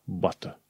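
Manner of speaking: "butter" with a true T, as "but-uh"; British clear T. Shown as a demonstration. Teacher showing British "butter" with clear T. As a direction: sound the T in "butter". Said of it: "trebuie să pronunți cu T".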